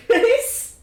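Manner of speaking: usual speaking voice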